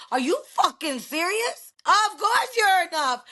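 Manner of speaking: high, slow, rising outcry to the rooftops; emphatic outcry. Real, not acted. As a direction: pitch it up and drag it out in disbelief. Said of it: "I wanna yell to the rooftops".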